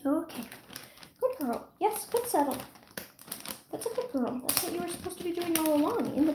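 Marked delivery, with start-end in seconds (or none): 1.2-6.1 soft praise. Real, not acted. Calming settled puppy.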